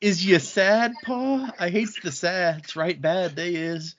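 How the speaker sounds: lightly voiced country kid, slow; country bumpkin voice. Friend imitating Max from the book, a mild country bumpkin accent. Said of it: "I didn't hear Wayne Junior".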